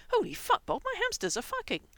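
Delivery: high, loud startled shout. A narrator's reading. Gerard calling to Bob about the hamsters, loud high shout. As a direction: yell it across the room in shock. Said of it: "Gerard's muffled voice shouting".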